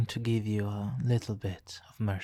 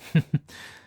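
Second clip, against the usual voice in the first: short, louder mocking chuckle; villain's chuckle; 6 dB louder than usual. Villain watches the nemesis struggle, amused mocking chuckle. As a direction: laugh at the struggling captive.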